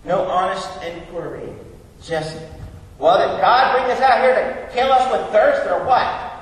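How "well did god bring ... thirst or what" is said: high, loud mock grumbling; whining complaint. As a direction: imitate an indignant crowd griping. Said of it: "They whined and moaned and groaned".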